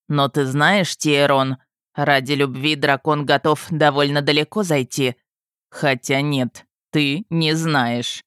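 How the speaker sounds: low, needling taunt; wry husband. A narrator's reading. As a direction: needle him low, slowing for the final jab. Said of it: "усмехнулся Авалон".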